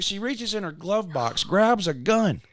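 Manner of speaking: ordinary delivery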